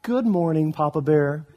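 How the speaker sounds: a hint of weaponized innocence; sweetly innocent. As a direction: play the angel after winning. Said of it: "he says".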